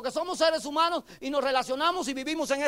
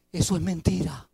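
devil voice; 7 semitones lower than usual. Retold or imitated. Devil voiced in sermon story.

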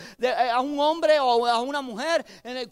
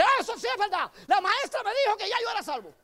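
put-on child voice; 8 semitones higher than usual. Child voiced answering devil.

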